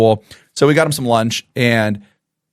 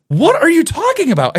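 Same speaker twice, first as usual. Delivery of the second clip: much higher, disbelieving squeal; can't believe what you just heard; incredulous. Retold or imitated. Teacher's incredulous reply retold.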